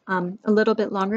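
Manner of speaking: ordinary delivery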